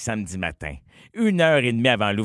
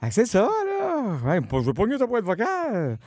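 voix aiguë et traînante d'un ami; message vocal de chum; 6 semitones higher than usual. Retold or imitated. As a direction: imiter un ami qui laisse un message vide. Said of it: "puis que ton message, c'est". (voix aiguë de chum au répondeur)

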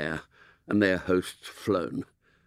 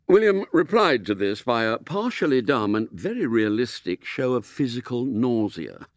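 ordinary delivery, nothing marked

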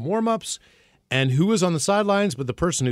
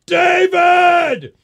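high, loud call across the field; shouting a name to get the boss's attention; 9 dB louder than usual. Retold or imitated. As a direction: call out the owner's name at full volume. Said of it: "So I turn and go".

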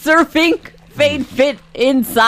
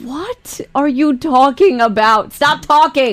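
exasperated; 4 semitones higher than usual. Real, not acted. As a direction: tell a rambling co-host to stop, fed up. Host exasperated at co-host's tangent.

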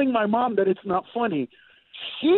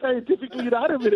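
usual speaking voice

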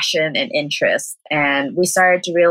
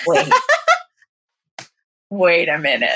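laughing. Real, not acted. Realizing the name, laughing.

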